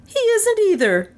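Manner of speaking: very high woman's retort; high, adamant female character; indignant contradiction. A narrator's reading. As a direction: contradict him stubbornly, high-pitched. Melissa contradicts him a second time.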